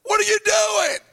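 high, loud outraged shout; angry homeowner yell. Acted. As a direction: yell up at the men tearing your roof. The guy that owns the house going.

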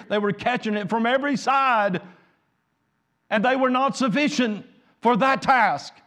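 preaching at full force. Acted. Pastor urging the congregation onward, preaching with rising force.

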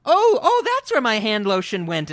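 high, loud "oh, oh" of sudden discovery; mock oblivious surprise. Host acting out the distracted girlfriend in the film, mock surprise.

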